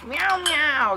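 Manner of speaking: very high cooing and meows. Real, not acted. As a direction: coo over the kittens. Cooing at the kittens.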